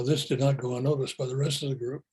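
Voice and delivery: normal talking voice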